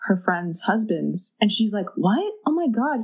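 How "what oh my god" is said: high, incredulous retold gasp of words; high-pitched acted disbelief; mock shock. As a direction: react as if just told shocking news. Retold shocked reaction to news.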